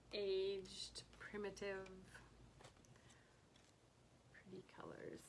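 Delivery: ordinary talk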